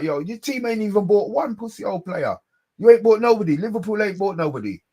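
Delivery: high-pitched scornful telling-off; indignant taunt. Acted. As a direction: throw it heatedly at a rival pundit. Mock address to rival pundit Souness.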